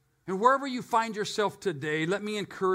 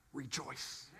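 much softer, breathy rejoice; hushed rejoice; 5 semitones lower, 16 dB quieter than usual. Shown as a demonstration. Preacher repeating rejoice softly for the second time. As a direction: let rejoice fall to a hush. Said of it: "And again, I say".

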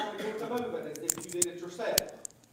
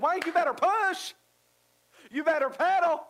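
higher and louder mock scold; mom's scolding; 9 semitones higher, 7 dB louder than usual. Mother in paddle boat scolding son, loud and high. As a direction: bark at him to keep pedaling. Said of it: "she said".

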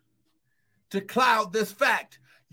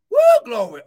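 ecstatic shout; 9 dB louder than usual. Real, not acted. Preacher's ecstatic exclamation.

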